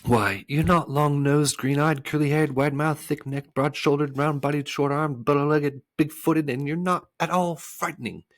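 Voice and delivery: put-on high, loud indignant boy. A narrator's reading. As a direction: list it off in indignant protest. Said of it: "said Milo indignantly".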